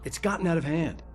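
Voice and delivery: frustrated. Acted. Frustrated reply in quarrel.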